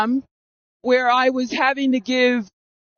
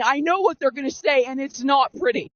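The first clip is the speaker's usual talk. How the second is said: ordinary talk, nothing marked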